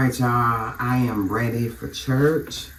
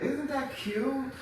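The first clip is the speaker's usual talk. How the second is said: delighted high coo; 14 semitones higher, 10 dB quieter, slower than usual. Real, not acted. Showing off her outfit.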